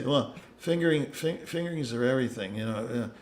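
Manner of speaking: low, hushed, halting older-colleague impression; Phil Kaywin impression. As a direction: do your friend, murmured low with little stumbles. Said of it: "that's my impression of Phil Kaywin".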